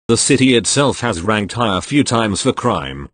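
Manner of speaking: plain reading voice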